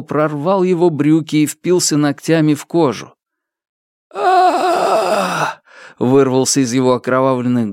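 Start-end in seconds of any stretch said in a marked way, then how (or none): 4.1-5.6 long, high cry of pain; pained moan. A narrator's reading. Beaten youth with bloodied lips, painful moan. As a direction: let out a drawn-out pained wail. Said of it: "болезненный стон".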